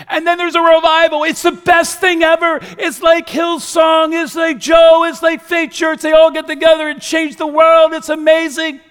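high, loud, over-the-top enthusiasm; mock revival hype. Preacher hyping Nineveh's revival to the church, mock-ecstatic shouting. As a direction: shout it like a gushing hype man.

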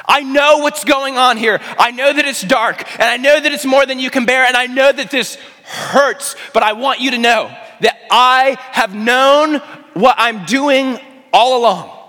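high, loud, urgent preaching cry; impassioned shout. Retold or imitated. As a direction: proclaim it at full force, pleading to be believed. Preacher cries out as Jesus.

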